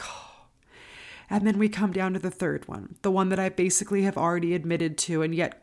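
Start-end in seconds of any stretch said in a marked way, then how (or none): 0.0-0.5 very soft exhaled oh; hushed sigh of relief. Retold or imitated. Defendant hearing second not guilty, hushed relief. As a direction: breathe it out, barely audible.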